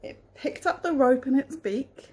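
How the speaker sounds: storybook reading voice; reading to children. A narrator's reading. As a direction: read it gently to a child. Reads a picture book aloud.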